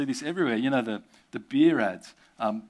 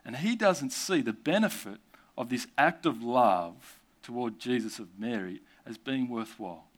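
usual speaking voice

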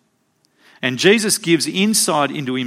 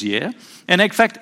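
usual speaking voice

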